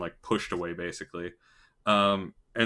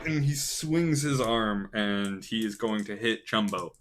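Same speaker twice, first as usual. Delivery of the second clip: ordinary delivery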